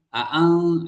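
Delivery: "a'ang" with a stretched a and a glottal catch, slow; drawn a'ang. Shown as a demonstration. Teacher demonstrating another reading variant, slow drawn "a'ang". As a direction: draw out "a'ang". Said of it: "ada yang".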